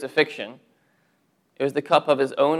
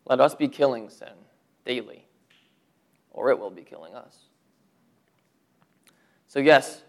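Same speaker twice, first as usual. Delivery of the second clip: ordinary delivery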